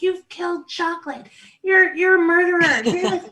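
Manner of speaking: high, loud hammed-up accusing; mock accusation. Older daughter accusing her father of gerbicide, mock fury. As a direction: shout it at dad like a verdict. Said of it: "she accuses him of gerbicide".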